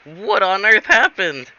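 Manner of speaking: high, loud, drawn-out astonishment; acted shock. Robot seeing friend fly out of fireplace, acted shock. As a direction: cry it out in hammed-up disbelief. Character shocked by sooty arrival.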